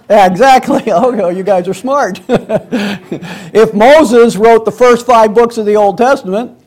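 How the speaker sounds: laughing between phrases; real laughing reply; amused laughter. Real, not acted. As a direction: chuckle through the words, amused by the class. Amused by the audience's answer.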